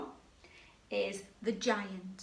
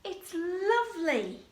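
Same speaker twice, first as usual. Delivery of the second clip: higher, dreamy sigh of a voice; Cinderella's delight; 9 semitones higher, 4 dB louder, slower than usual. Shown as a demonstration. Teacher voices Cinderella at the ball, higher and dreamy. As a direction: marvel like a princess at the ball. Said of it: "We have Cinderella".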